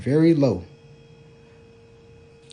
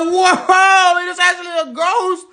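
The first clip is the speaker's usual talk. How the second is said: high, loud startled gasp; suddenly spooked, half joking; mock alarm; 11 semitones higher, 4 dB louder, slower than usual. Father hears the toilet screaming, high spooked alarm.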